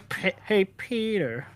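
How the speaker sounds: lightly mimicked cartoon catchphrase; Family Guy impression. Friends trading Family Guy voices, cartoon impression. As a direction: imitate Peter's cartoon family calling him.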